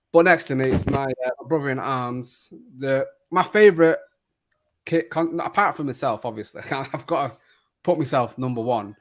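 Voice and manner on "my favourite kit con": ordinary delivery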